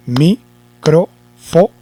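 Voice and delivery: "microfo" as mi, cro, fo, each rising, with pauses; syllable by syllable. Shown as a demonstration. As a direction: chop microfo into slow single syllables. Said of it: "entonces yo digo". (microfo sílaba por sílaba, lentamente)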